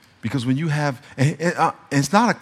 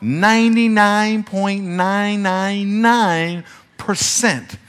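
slow drawn-out exaggeration; 4 semitones higher, much slower than usual.

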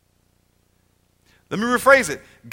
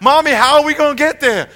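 lightly voiced little child, raised and louder; little child asking; 6 semitones higher, 7 dB louder than usual. Child pestering mommy about being late, lightly voiced. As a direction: play a child pestering mom from the back seat. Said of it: "when your little child says".

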